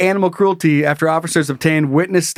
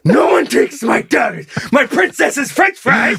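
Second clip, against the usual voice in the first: hammed-up shout, higher and drawn out; protective dad rage; 6 semitones higher, slower than usual. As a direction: roar in mock defence of your little girl. Acted dad defending daughter.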